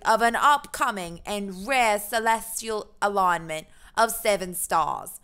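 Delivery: put-on awful British accent. Streamer reading out the dinner report, awful British accent. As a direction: declaim it in a badly faked British accent. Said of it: "most awful British accent".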